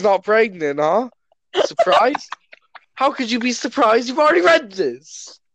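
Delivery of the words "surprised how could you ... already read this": teasing interrogation. Pretend.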